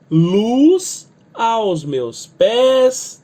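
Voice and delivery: "luz aos meus pés" very slow, luz and pés stressed. Shown as a demonstration. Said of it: "Eu tenho que acentuar o luz e aos pés". (acentue luz e pés, bem devagar)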